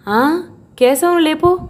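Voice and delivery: put-on high, slow, strained old man's call; an elderly man stirring from bed, urging her. A narrator's reading. Old father-in-law tells Arundhati to wake Keshava, high slow call.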